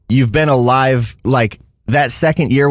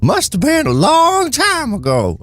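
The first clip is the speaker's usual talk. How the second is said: put-on high, creaky old gold miner; old prospector; 13 semitones higher than usual. Shown as a demonstration. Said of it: "I don't know why I sound like an, uh, old prospector".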